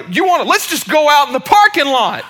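high, loud mock dare; imitated angry challenge. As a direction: act out a hothead picking a fight. Customer challenges speaker to a fight.

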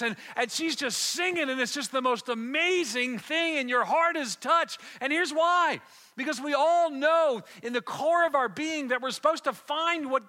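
loud, high, full-force sermon climax; impassioned preaching. Real, not acted. Preacher rousing the church over gifts discovered, impassioned shouting.

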